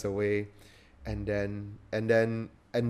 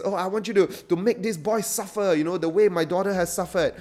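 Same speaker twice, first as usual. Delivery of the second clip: higher, eager pleading; protesting plea; 7 semitones higher, 3 dB louder than usual. Retold or imitated. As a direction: beg the Don to name his price. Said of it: "Bonazera protests".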